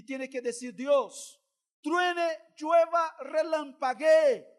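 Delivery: loud, driving sermon delivery; full-force pulpit preaching; fiery preaching. Real, not acted. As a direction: preach it hard to the congregation.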